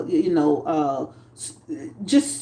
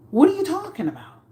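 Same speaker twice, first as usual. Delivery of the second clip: quick, much higher question; higher and fast, baffled; incredulous; 8 semitones higher, faster than usual. Real, not acted. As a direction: fire it off in disbelief.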